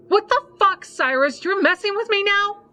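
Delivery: very high, loud shout; furious shout. Acted. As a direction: scream it at him in rage. Lily furious at husband.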